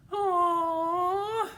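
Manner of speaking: high whiny "aw"; whiny kid. Acted. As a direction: whine like a small kid. Little P disappointed.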